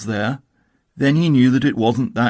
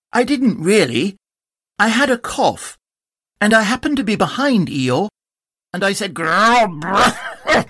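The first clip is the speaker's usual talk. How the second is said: higher, bouncy tiger voice with growl; Tigger voice; 8 semitones higher than usual. Acted.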